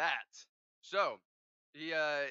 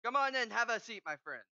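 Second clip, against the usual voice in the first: high, louder welcoming banker; friendly banker; 8 semitones higher, 5 dB louder than usual. Acted. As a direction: greet a rich client warmly. GM voices banker inviting.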